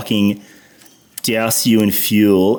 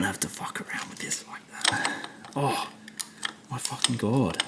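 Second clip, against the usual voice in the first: frustrated whisper; 13 dB quieter than usual. Real, not acted. Still frustrated at terminal.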